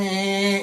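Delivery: high, drawn-out mechanical whine; imitated tool whine. Officer mimicking the autopsy tools, high whine.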